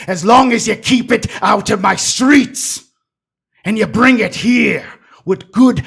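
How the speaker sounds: loud, high threatening official; menacing. Acted. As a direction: lean on them with a loud threat. NPC threatening speech.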